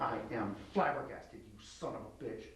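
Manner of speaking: grit your teeth and push the words out; through clenched teeth. Shown as a demonstration. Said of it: "Get through clenched teeth now".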